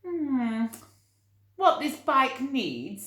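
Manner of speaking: hammed-up pondering lady; Mrs Armitage musing. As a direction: put on a prim old lady voice. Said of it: "said Missus Armitage to herself as she cycled along".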